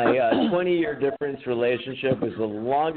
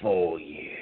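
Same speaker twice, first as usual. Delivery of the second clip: low, slow whisper; whispered Chris impression; 6 semitones lower, slower than usual. Ex recalls roommate leaning in with a whisper. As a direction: hush it out like a secret offer. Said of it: "And he'd be like".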